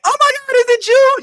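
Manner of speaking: loud, very high fan squeal; mock starstruck gushing. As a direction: play an overexcited fan meeting an idol. Mimicking a starstruck meeting with Harold.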